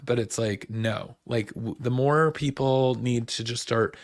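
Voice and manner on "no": ordinary talk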